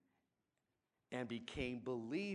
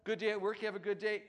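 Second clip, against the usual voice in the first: a hint of a dad coming home, louder and higher; cheery dad greeting; 9 semitones higher, 7 dB louder, much faster than usual. A lightly voiced dad greeting his kids, louder and higher. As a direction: call out to the room like a dad home from work. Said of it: "It's just like a dad walking".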